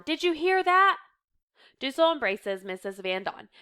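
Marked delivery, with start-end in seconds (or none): 0.0-1.0 high, loud, thrilled question; elated. A narrator's reading. Reader voicing a woman hugging her housemate, elated. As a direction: marvel aloud at the news. Wild celebration at the news.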